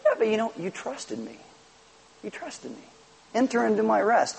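soft, hushed reassurance; Jesus welcoming the believer home, softly reassuring; tender reassurance. Pretend. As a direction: comfort a weary servant. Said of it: "He's gonna be like".

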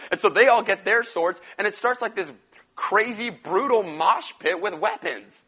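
usual speaking voice

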